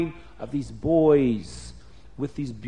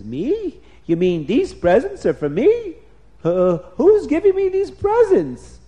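astonished king; 9 semitones higher, 6 dB louder than usual. A narrator's reading. King learns the presents are for him, astonished. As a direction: pitch climbing in disbelief.

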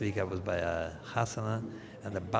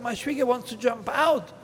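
high, loud panicked plea; frantic caller; 11 dB louder than usual. Acted. Man begs people who ran up, high and loud. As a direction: beg the neighbours for help. Man voiced asking neighbours for help.

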